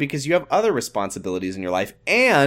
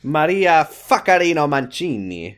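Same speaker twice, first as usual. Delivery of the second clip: higher, rolled mock-Italian name; mock Italian accent; 4 semitones higher than usual. Joking about an Italian middle name, hammy Italian accent.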